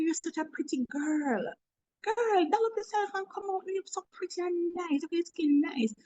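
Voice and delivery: butter her up gently with praise; kind and sweet. Retold or imitated. Boss to shabby coworker, kind sweet encouragement. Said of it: "in the most kindest, sweetest way".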